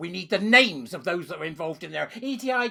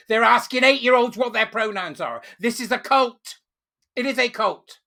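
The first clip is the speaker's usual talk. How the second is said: mock outraged quote; 5 semitones higher, 6 dB louder than usual. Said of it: "by saying".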